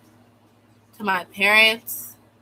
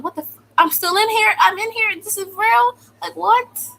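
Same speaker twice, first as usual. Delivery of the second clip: much higher, loud, stunned questions; shocked disbelief; 12 semitones higher, 6 dB louder than usual. Retold or imitated. Girl waking up still locked in, shocked disbelief. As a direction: wake up and can't believe it.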